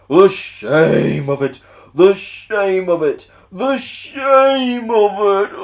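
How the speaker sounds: loud, slow, rising lament; ashamed Gordon. Acted. Proud engine lamenting the dirty coal trucks. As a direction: wail it in wounded pride. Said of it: "he groaned".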